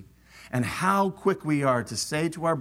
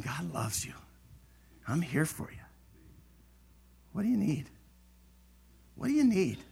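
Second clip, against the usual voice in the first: much softer, gentle offer; caring brother; 7 dB quieter, faster than usual. Retold or imitated. Coming alongside a struggling sister, soft and caring. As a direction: say it softly, meaning it. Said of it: "and saying".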